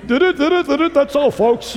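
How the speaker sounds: high, stuttering cartoon sign-off; hammed-up cartoon stutter, pitched high; Porky Pig. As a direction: stammer through it like a cartoon pig. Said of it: "like Porky Pig".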